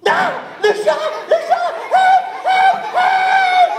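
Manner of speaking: shrill, very high screaming for help; panicked scream. Acted. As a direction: shriek in terror like a tiny sheep.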